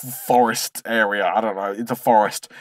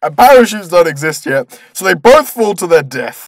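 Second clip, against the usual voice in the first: ordinary talk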